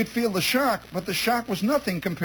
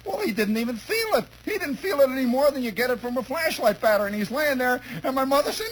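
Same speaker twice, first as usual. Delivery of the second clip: ordinary talk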